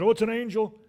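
high, loud, mock astonishment; amazed onlooker. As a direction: exclaim like an awed bystander. Crowd reacting to heavenly voice.

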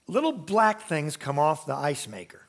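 ordinary talk

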